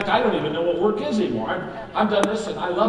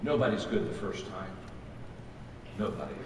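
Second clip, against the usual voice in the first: soft reassurance; 11 semitones lower, 8 dB quieter than usual. Real, not acted. Reassuring a nervous first-timer.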